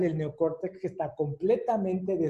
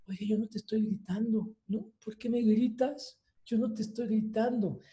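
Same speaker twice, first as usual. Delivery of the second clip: softer, gentle calming voice to defuse a yelling person; calm soothing tone; 3 semitones higher, 7 dB quieter than usual. Shown as a demonstration. Instructor shows how to calm a yelling person, soft soothing tone. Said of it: "yo tengo que cambiar mi tono".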